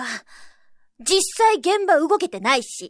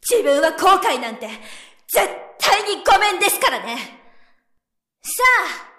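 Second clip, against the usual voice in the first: loud, high and forceful tirade; heated defiance; 6 semitones higher, 4 dB louder than usual. Acted. Subordinate defying the board at a meeting, angry tirade. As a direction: tear into the board, no backing down.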